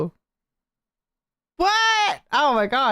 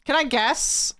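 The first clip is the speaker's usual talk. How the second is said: usual speaking voice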